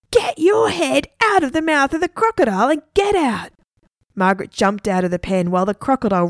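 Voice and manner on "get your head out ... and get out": high, restrained storybook scream. A narrator's reading. Egbert ordering Margaret away from the crocodile, screaming. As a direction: scream the order at a much higher pitch. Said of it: "Egbert screamed at Margaret".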